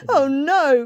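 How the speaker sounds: lightly acted alarm; alarmed cry. A narrator's reading. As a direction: cry out in mild dismay. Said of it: "cried Newton".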